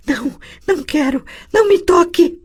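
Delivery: put-on the girl begging not to be touched; panicked refusal. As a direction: shriek to keep someone away.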